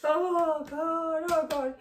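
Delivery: high, loud teary laugh-cry; real tears turning to a laugh; teary gasp. Real, not acted. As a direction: catch your breath after crying. Recovering from crying, half laughing.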